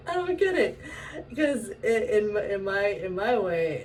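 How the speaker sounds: high, loud words through laughter; real laughter running into the words; laughing. Real, not acted. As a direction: talk while still cracking up. Still laughing after her "How?.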